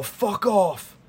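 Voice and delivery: high-pitched mock yell; retold yell. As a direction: imitate yelling through a door, without much volume. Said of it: "and I yell".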